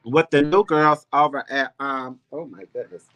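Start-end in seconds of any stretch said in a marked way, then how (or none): none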